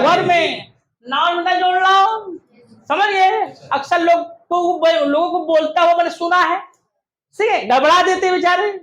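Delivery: ordinary talk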